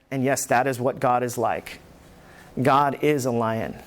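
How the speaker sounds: usual speaking voice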